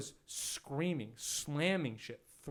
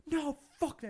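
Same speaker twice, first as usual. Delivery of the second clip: much higher mock outburst; retold angry refusal; 14 semitones higher than usual. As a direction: imitate someone freaking out. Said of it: "this guy is, like, freaking out".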